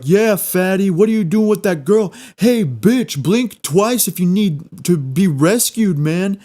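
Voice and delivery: high jeering bully voice; mocking heckler voice. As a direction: taunt him like a frat bro. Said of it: "the other guys chime up and they're like".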